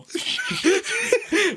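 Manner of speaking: sudden high burst of laughter. Real, not acted. Cohost laughing at the queen's dominance, high laugh. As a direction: crack up at the joke.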